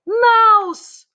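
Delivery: very high, loud shriek of alarm; man's yell. A narrator's reading. Cook spots the mouse and yells in alarm. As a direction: yell it in shock. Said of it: "I heard a man yell".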